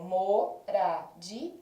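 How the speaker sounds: "moradia" said slowly in separate syllables: mo-ra-di-a; teacher slowly sounding out "moradia" as mo-ra-di-a; split into syllables. Shown as a demonstration. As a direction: pull "moradia" apart, syllable by syllable. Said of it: "Quando eu separo moradia em sílabas".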